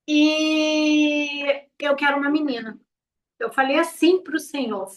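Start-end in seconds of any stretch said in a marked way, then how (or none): 0.1-2.8 slow, drawn-out cry; crying out plea. Retold or imitated. Mother begging God for a daughter, slow cried-out plea. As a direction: plead for a daughter, calling out slowly.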